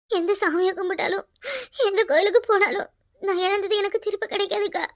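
usual speaking voice

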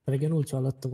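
ordinary talk, nothing marked

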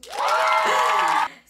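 high breathy burst of laughter; loud laugh. Real, not acted. After the Spanish punchline, loud laughter. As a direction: crack up at the punchline.

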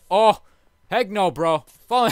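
sudden loud high yelp; startled shout. Real, not acted. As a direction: caught off guard, loud. Mob falls into lava.

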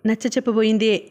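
ordinary delivery, nothing marked

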